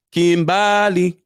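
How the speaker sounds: Kimbali stretched slow and loud, imitating him; mock Kimbali, slow and emphatic; his way of saying it. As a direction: drawl out Kimbali like he does. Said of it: "That's how he says it".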